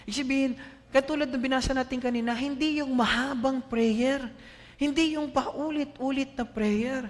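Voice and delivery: high, loud impassioned preaching; fervent sermon peak; preaching at full force. Acted. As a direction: drive the point home to the church. Preaching climax.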